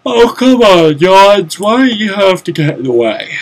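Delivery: loud, high exasperated whine; exasperated complaint. Real, not acted. Player blocked by Johns, loud exasperated whine. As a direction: whine at the one in the way.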